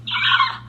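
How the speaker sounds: sudden high gasp of shock; real startled gasp; sharp gasp. Real, not acted. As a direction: gasp as the necklace snaps. Necklace breaks.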